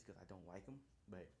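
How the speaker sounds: ordinary delivery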